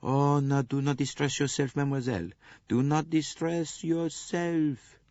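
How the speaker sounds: calm a crying woman; soothing Poirot. Acted. Poirot soothing weeping nurse.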